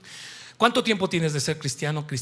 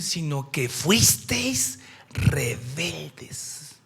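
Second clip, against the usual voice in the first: slow whisper; 4 semitones lower, much slower than usual. Acted. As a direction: whisper it very slowly. Lee el versículo despacio. (susurro muy lento)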